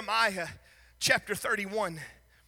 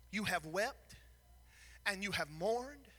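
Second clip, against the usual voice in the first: much softer, lower, drawn out; tender address; 3 semitones lower, 7 dB quieter than usual. Acted. Preacher consoling a grieving listener, soft and tender. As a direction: speak gently to the hurting.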